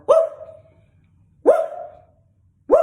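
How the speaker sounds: short spaced woo barks; steady barking woos; imitated dog barking. Shown as a demonstration. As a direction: bark while standing still. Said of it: "barking and standing there like".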